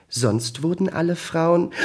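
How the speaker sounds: high voice building into a sneeze; about to sneeze. A narrator's reading. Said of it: "musste kurz daraufhin noch einmal niesen".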